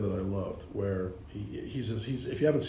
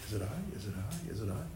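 much softer, repeated anxious asking; hushed echo; 3 semitones lower, 10 dB quieter than usual. Retold or imitated. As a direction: murmur it like a frightened disciple. Disciples' anxious question, echoed.

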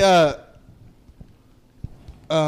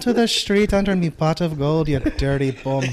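louder, sing-song leprechaun Irish; 3 semitones higher, 4 dB louder than usual. Acted. Friend shows how his Irish turns leprechaun, louder and sing-song. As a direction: chirp it like a leprechaun. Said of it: "sounding like a fucking leprechaun".

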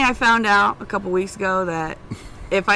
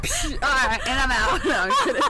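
playful laughing; 6 semitones higher than usual. Real, not acted. Jokes about dropping the mic.

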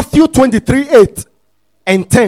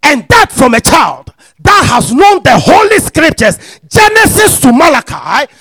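full-force preaching; 5 semitones higher, 4 dB louder than usual. Acted. Preacher driving scripture home to the congregation, full-force preaching. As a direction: preach it out, pitch climbing towards the last word.

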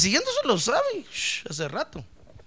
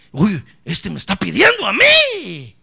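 excited shout, climbing higher and louder; God thrilled, urging angels on; eager God; 7 semitones higher, 11 dB louder than usual. Retold or imitated. God eagerly ordering help for the believer, shouting higher and higher.

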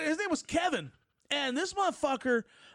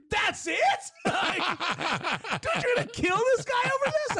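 disbelieving friend; 11 semitones higher than usual. Retold or imitated.

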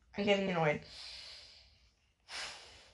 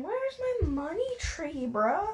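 exasperated whine; 6 semitones higher than usual. Real, not acted. Exasperated searching.

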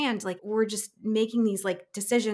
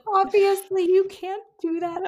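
high, drawn-out, overly agreeable; flustered backpedalling; 11 semitones higher, 6 dB louder, slower than usual. Retold or imitated. Casting director refused by counter woman, high embarrassed backpedal. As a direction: back down in a slow, high-pitched fluster.

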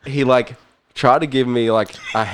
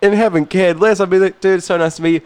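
excited; 7 semitones higher, faster than usual. Real, not acted. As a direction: pitch it up with excitement. Telling it with rising excitement.

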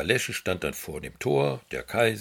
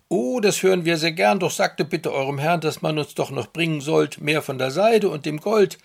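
greedy weaver; 6 semitones higher, 8 dB louder than usual. Acted. Weavers ask for more gold.

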